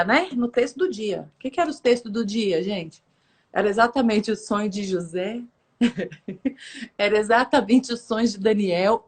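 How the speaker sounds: ordinary talk, nothing marked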